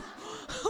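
high breathless laugh; real laughter; burst of laughter. Real, not acted. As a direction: laugh helplessly at the joke. Laughing at own reaction.

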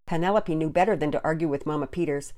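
usual speaking voice

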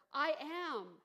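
loud, proclaiming call; Jesus calling over the waves; ringing out. Acted. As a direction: let it ring across the water. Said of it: "it rings out over the water".

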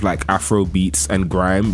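ordinary delivery

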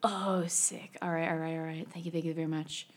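soft whispery beach bum; quiet, laid-back surfer thanks; surfer dude voice. Acted. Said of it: "a little bit of David".

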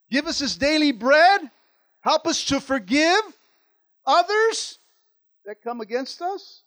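loud, high, driving sermon voice; full-force preaching. Acted. Preacher driving his point home to the church, loud and fervent. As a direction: preach it out to the congregation.

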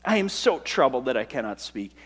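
"I am so troubled that I cannot speak" said softly, sinking in despair. Shown as a demonstration. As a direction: let "cannot speak" fall away hopeless and soft. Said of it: "He's in despair".

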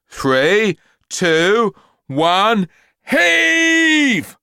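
loud count rising to a high heave; called out. Acted. Dad calling the count down the human chain, loud. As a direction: call the count to the whole street. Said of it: "called out Dad".

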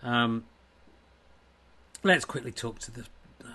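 normal talking voice

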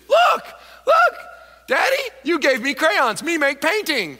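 put-on high, loud excited child voice; little kid. A little kid shouts to Daddy over his painting, squealing high. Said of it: "That's what kids do".

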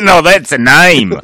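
high, loud mock Australian; hammed-up Australian; Australian accent. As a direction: shout it in a broad Aussie accent. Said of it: "That's the only Australian I know".

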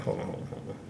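near-whispered, breathy warning; whispering hold on to the crowd; hushed hold on. Retold or imitated. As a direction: cut them off in a low hush. Acted hallway confrontation, own quote.

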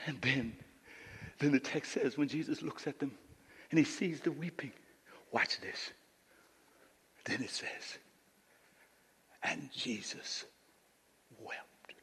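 low, soft, with long pauses; hushed, solemn reading. Acted. Preacher reading how Jesus saw the weeping, hushed. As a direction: let it sink to a whisper. Hushed climax of Bible story.

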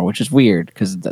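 normal talking voice

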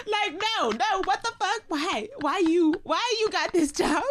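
high, laughing mock fury; mock jealous rant. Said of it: "I was like".

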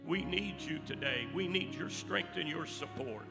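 prayer building to a loud, high climax; fervent prayer. Acted. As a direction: pray it out, rising to a shout. Closing prayer building to full force.

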